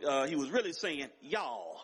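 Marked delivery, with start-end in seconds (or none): 1.2-1.7 a touch of southern US twang. Shown as a demonstration. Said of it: "put some southern twang on it".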